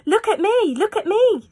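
high, loud show-off cat; high boastful cat call. Acted. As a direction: crow to be watched. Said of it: "said the cat".

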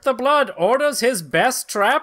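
high, loud pulp-comic narrator; dramatic comic narration. Acted. Narrator introducing the villain, high and dramatic. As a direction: announce it like a breathless old serial.

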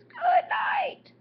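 very high, loud trumpeting elephant. Acted. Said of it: "The elephant says".

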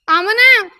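calling out. Acted. Calling sister-in-law from another room.

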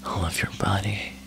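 ordinary talk, nothing marked

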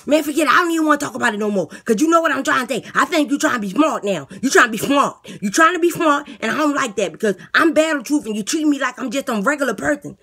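eager inmate talking up his plan; lisping boastful inmate. Acted. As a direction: beg the guard like a cocky jailbird. Inmate sulks at guard.

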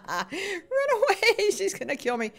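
laughing while fleeing. Real, not acted. Gamer laughing as the attacker chases her. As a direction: giggle out run away.

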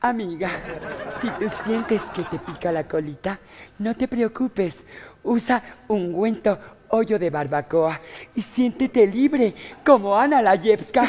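slow, drawn-out commercial voice; ad read. Acted. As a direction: savour each word like a TV spot, slowly. Third take of the commercial. (anuncio comercial lento y meloso)

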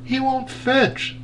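high, slow, soft little dog; a lightly voiced complaining dog. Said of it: "said Dog".

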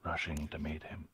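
measured storytelling with long pauses; game master narration. Acted. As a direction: narrate a vision phrase by phrase.

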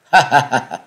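loud villainous laughter; booming laugh. A narrator's reading. Hidden stranger answers the challenge with booming laughter. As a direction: laugh out boldly from hiding. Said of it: "một trận cười đinh tai nhức óc".

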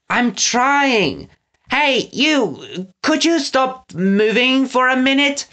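high, flustered henchman's call. Acted. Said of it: "Pickles c-, called".

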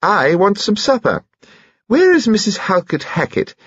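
high-voiced imperious old lady; eccentric dowager. Dowager complains about congratulating hostess, high and imperious. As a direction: declare it in a high, insistent voice.

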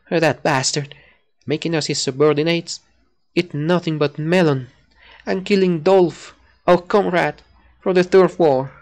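rising bitter character rant. Acted. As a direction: build from grudge to loud fury, slowing on the start. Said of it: "I gotta keep that voice fresh".

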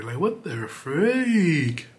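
very slow, drawn-out complaint; slow exasperated whine. Real, not acted. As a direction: drag it out in disbelief. Frustrated at slow render.

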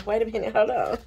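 ordinary delivery

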